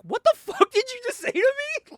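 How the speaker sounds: sudden high, indignant snap; outraged retort. Retold or imitated. Kyle snapping back at Wally, high and indignant. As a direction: fire it back, offended and high. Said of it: "Kyle's like".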